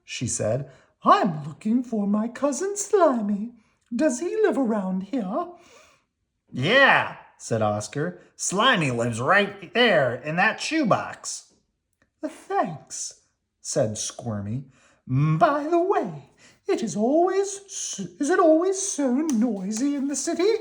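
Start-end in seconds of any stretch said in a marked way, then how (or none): 1.0-5.5 country cousin worm, polite. Acted. Squirmy voiced. 6.5-7.2 gruff grouchy Oscar; grumpy garbage-can grouch. Acted. Oscar voiced. 8.4-11.5 gruff grouchy Oscar; grumpy garbage-can grouch. Acted. Oscar voiced. 12.2-13.2 high, slow sweet worm voice; country cousin worm, polite. Acted. 15.1-20.5 country cousin worm, polite. Acted. Squirmy voiced.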